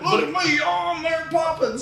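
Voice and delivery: mocking squeaky high voice; mock him in a high, silly voice. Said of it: "He's like".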